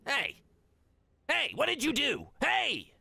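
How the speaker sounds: high, loud goblin heckling; madcaps shouting. Acted. Madcaps heckle the party, high and loud. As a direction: yap up high at the stranger. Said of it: "Some of the madcaps nearby go".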